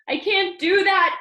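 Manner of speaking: king crying out against giving his things away. A narrator's reading. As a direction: plead it out loud, refusing. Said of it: "cried the king".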